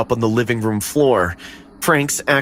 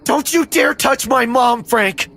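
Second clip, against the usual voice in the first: defiant shout; 13 semitones higher than usual. Acted. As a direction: yell at him to back off. Boy defends his mother.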